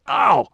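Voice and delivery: sharp high yelp of pain; pained cry. A narrator's reading. Injured man yelping in sudden pain. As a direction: yelp as the ankle gives.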